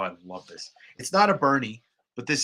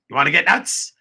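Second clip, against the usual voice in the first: mock taunt; 4 semitones higher, 8 dB louder than usual. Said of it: "Lamar, you wanted some music".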